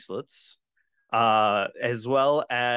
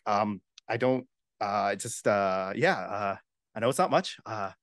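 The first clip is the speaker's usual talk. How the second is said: put-on awkward mumbling teen; 2 semitones lower than usual. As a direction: hesitate sheepishly, pitched down, then let it out. Awkward gift-giver acted in game.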